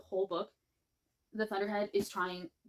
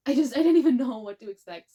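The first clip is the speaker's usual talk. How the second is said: much louder, higher rush of feeling; excited outburst; 6 semitones higher, 14 dB louder than usual. Real, not acted. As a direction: blurt it out overwhelmed. Reliving the tension of reading.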